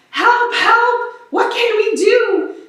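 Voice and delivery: hammed-up frightened townsfolk; panicked cry. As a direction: call out in mock panic. Crowd panics at fire.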